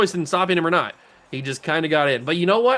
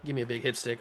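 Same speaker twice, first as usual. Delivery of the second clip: ordinary talk, nothing marked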